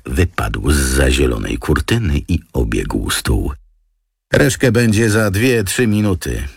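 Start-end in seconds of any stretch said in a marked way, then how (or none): 4.3-6.5 higher-pitched underling answering; aide's voice. A narrator's reading. Aide reporting to the boss on Reszke, higher-pitched underling voice. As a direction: give the aide a raised, lighter-pitched voice.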